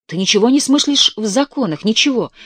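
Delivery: loud scolding. Acted. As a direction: scold her like a naive child, full voice. Husband scolding wife in quarrel.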